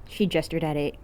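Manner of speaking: ordinary delivery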